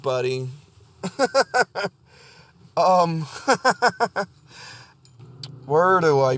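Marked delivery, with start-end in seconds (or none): 1.0-1.9 high giggling burst; laughing. Real, not acted. Podcaster cracking up after imitating the preacher, high laugh. 3.2-4.2 high, giggly peal; helpless amused laugh. Real, not acted. Podcaster still amused by his preacher imitation, high laugh.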